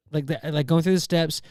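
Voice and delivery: usual speaking voice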